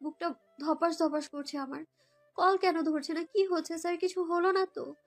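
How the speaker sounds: ordinary delivery, nothing marked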